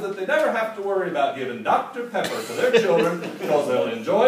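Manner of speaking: mock church response, ending in laughter; liturgy-style response. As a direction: answer the call like a congregation, building up. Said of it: "like they were reciting a call and response church liturgy".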